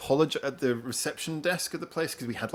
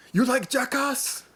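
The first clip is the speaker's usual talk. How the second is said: high, slow put-on voice; friendly translator; 11 semitones higher, slower than usual. Translator chatting to calm the detainee, high and slow. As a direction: ask it slowly like a friendly foreigner. Translator making small talk.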